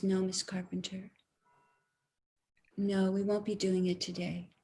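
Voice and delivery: doctor breaking bad news quietly; hushed grave doctor. Doctor answering patient's fear, soft and solemn.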